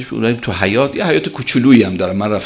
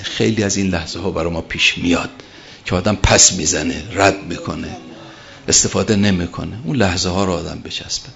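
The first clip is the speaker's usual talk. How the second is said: ordinary talk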